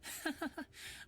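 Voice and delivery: short airy laugh, high; breathy laugh. Acted. Luca laughs off Xander's teasing with a breathy laugh. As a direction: laugh it off, pleased with himself.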